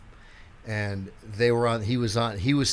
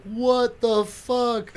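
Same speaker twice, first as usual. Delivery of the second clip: stunned disbelief; 10 semitones higher, much slower than usual. Retold or imitated. Stunned at the slap.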